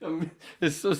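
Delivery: high voice breaking up with laughter; real laughter. Real, not acted. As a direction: try to talk while cracking up. Helpless laughter at own look.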